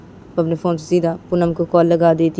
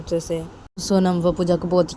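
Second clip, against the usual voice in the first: usual speaking voice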